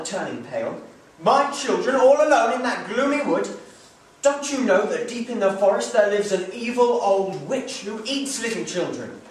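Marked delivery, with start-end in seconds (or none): 1.2-9.2 high, loud, urgent alarm; alarmed father. Acted. Father warning his wife of the witch, alarmed. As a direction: warn her in horror.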